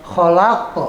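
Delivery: "Kholak" with rasped kh and bounced q; correct throaty kh. Shown as a demonstration. Teacher repeating the correct form, throaty kh in "Kholak". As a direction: scrape the kh of "Kholak". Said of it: "Holak salah nanti".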